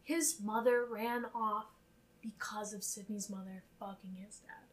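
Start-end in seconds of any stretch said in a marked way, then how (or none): 2.2-4.6 whispered scandalous aside; gossipy whisper. Real, not acted. Podcaster whispering the scandal to her cohost. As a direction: drop to a conspiratorial whisper.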